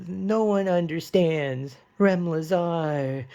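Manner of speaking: high, slow dramatic sigh line; melodramatic teen girl. Retold or imitated. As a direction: drag it out like a tragic teen. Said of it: "And then she's".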